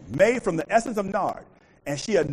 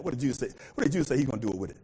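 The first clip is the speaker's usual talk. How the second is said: ordinary delivery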